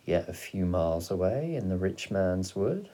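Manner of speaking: plain reading voice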